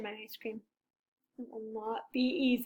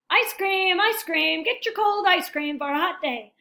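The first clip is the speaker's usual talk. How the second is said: higher, louder vendor's sing-song cry; ice cream vendor call; 5 semitones higher, 6 dB louder than usual. Acted. Vendor hawking ice cream to a hot crowd, loud and high. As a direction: call out to passing customers.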